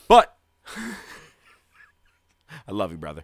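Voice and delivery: laughing affectionate jab. Real, not acted. Teasing sign-off to co-host.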